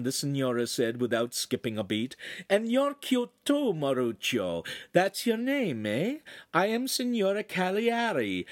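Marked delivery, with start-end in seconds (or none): 2.5-3.7 high, slow cooing compliment; sugary hostess voice; gushing signora. A narrator's reading. Signora fusses over the boy. 4.9-8.4 high Italian hostess voice; high-voiced lady of the house; Italian signora. A narrator's reading. Signora introduces herself.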